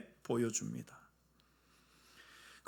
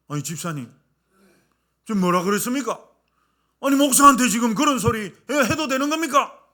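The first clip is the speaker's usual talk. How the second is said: indignant, rising higher and louder; mock angry retort; 6 semitones higher, 5 dB louder than usual. Pastor snapping at the deacon, mock indignant. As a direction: pastor huffing back, offended. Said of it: "성질을 냈어요".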